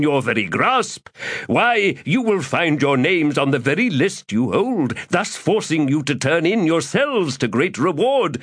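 florid, theatrical gentleman rogue; lofty, self-pleased orator; pompous Crupper voice. Acted. Crupper warns.

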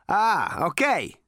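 brash, swaggering space rogue; cocky Zaphod. A narrator's reading. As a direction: sneer it at the robot. Zaphod's character voice.

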